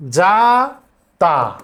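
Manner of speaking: usual speaking voice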